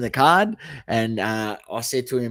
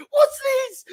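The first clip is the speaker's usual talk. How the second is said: much higher, shocked squeal of disgust; outraged what's this; 4 dB louder than usual. Retold or imitated. Mate spots Horne-Francis cards among his footy cards, shocked squeal. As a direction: squeal it like a fan betrayed.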